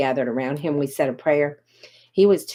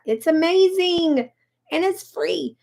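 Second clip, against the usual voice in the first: much higher, stretched-out praise; gushing excitement; 10 semitones higher, slower than usual. Real, not acted. Host gushing to her viewers about a free tool, excited. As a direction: rave about it like a fan.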